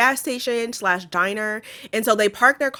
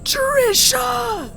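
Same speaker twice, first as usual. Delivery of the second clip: higher, wheezy and panicked; wheezing panic; 12 semitones higher, slower than usual. Pretend. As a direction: gasp the words out, desperate for the inhaler. Pretend asthma attack in pipe.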